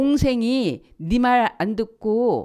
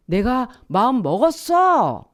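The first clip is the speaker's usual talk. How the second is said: slow, lightly voiced solemn God, a bit louder; voicing God; 4 semitones higher, 5 dB louder, slower than usual. Preacher voicing God's promise, a touch solemn and slow. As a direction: speak as God making up his mind, slowly.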